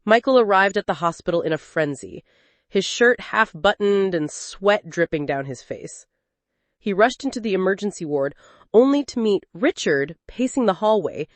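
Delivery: usual speaking voice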